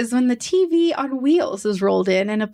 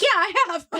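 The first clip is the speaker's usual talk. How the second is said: mock Steve reply; 10 semitones higher, faster than usual. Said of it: "And he's like".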